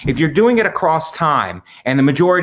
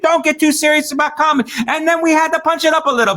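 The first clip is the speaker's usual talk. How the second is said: high mock voice; 14 semitones higher, faster than usual. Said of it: "He's like".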